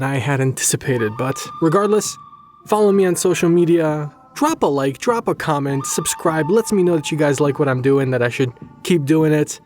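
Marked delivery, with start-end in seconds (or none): none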